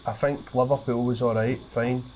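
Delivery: ordinary delivery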